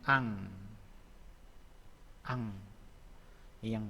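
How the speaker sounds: "ang" ending in a nasal ng; teacher modelling final ng in "ang"; batchim demo. Shown as a demonstration. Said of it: "contohnya nih".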